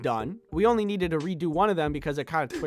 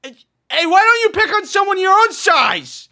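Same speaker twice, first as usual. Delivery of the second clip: much higher, loud, defiant yell; heroic shout; 11 dB louder than usual. Acted. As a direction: stand up to the bully at full volume. Hero yelling at the bully.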